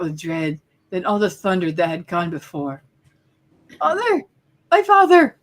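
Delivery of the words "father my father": high anguished shout; anguished cry. Acted. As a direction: cry out in grief. Boy cries for dead father.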